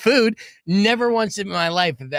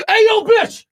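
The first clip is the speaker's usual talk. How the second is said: much higher, much louder crude yell from a truck; crude yelled catcall; 9 dB louder, slower than usual. Retold or imitated. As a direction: bellow it out the window at a passerby. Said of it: "he's like".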